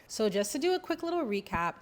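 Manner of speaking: ordinary delivery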